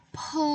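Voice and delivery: Pe with a puff of air; aspirated pe. Shown as a demonstration. Teacher repeats the breathy Pe for pupils. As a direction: push air out on Pe. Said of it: "Ada angin ya".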